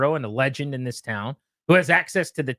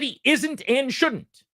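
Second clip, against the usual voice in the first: "isn't" and "shouldn't" drawn out slowly, pitched high; stress on isn't and shouldn't; 9 semitones higher, much slower than usual. Retold or imitated. Host quoting Theismann's exact words, "isn't" and "shouldn't" stressed. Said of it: "He didn't just say he shouldn't".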